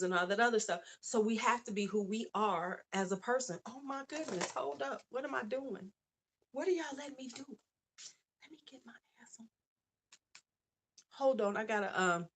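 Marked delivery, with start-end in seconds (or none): 3.7-7.4 soft, higher, startled murmur to herself; quiet fluster at her own slip; flustered aside. Real, not acted. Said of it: "startled aside at the screen". 8.4-9.5 fast breathy whisper to herself; hushed fast aside. Real, not acted. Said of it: "muttered aside".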